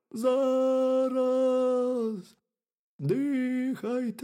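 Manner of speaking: high, drawn-out hare from underground; hollow, high wailing hare; muffled burrow voice. A narrator's reading. Hare answers from inside burrow.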